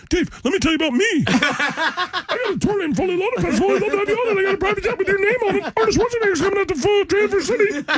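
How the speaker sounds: high, pushy, hammed-up bragging; cocky self mockery. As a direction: oversell yourself like a hype man. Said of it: "you don't think I went".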